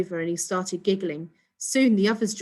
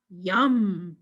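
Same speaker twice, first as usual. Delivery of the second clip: alien yum. A narrator's reading. Alien enjoys toilet water.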